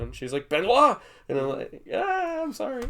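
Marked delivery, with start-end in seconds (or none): 0.5-1.0 loud, higher call of a name; a sharp classroom call; teacher calling out. Retold or imitated. Teacher snapping at a student. 1.9-2.8 much higher, meek apology; a kid caught out, voice pitched way up; sheepish student. Retold or imitated.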